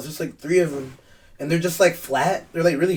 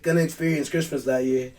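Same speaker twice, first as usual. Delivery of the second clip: ordinary talk, nothing marked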